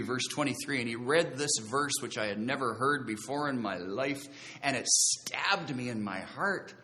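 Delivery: normal talking voice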